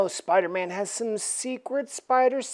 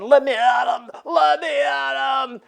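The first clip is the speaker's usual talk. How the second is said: louder, slowing, shouted demand; Thing shouting; 5 semitones higher, 3 dB louder than usual. Acted. Thing wanting to fight Spider-Man, shouting. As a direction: strain to charge in. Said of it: "the thing is saying".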